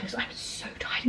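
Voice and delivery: breathy worn-out whisper; exhausted mum, whispered aside; tired whisper. Real, not acted. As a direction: whisper it like you're saving breath. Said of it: "save my breath".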